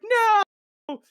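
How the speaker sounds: very high, loud cry of no; scream. Retold or imitated. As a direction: scream as he falls. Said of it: "Lucy's like".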